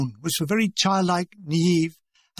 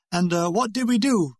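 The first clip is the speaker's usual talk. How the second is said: ordinary delivery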